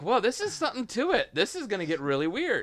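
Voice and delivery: high-pitched imitated viewer reaction; intrigued mock amazement. Producer voicing a viewer of the montage, intrigued amazement. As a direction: play the viewer suddenly hooked.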